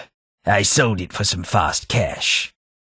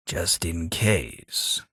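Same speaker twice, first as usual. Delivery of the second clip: ordinary talk, nothing marked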